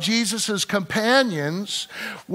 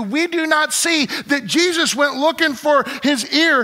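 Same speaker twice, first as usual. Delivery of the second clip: raised, fervent preacher voice, high and loud; preaching at full force; 5 semitones higher, 3 dB louder than usual. Acted. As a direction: drive the passage home to the congregation.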